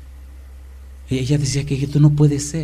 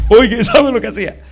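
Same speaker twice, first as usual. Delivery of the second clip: excited; 10 semitones higher, 8 dB louder than usual. Acted. Preacher building excitement.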